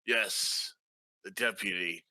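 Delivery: usual speaking voice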